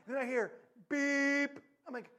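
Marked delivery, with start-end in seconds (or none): 0.9-1.6 loud, held, level beep; high sustained horn note; car horn imitation. Imitates car horn heard afar.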